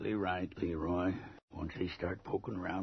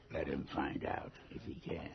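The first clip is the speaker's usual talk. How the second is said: normal talking voice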